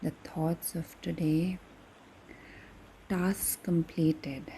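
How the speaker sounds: calm, unhurried meditation voice with long pauses; guided meditation. Acted. Guide leading listeners through breathing before bed, calm meditation voice. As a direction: ease them toward sleep, leaving space after each phrase.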